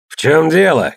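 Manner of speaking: shouted challenge, pitch jumping up; commanding shout. A narrator's reading. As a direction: call out sharply to a brawling crew. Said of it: "крикнул Тьор".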